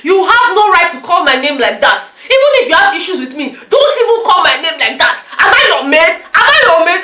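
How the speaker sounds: offended housemate demanding respect, mock angry; indignant. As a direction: tell her off, outraged she dares. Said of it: "Katrina was like".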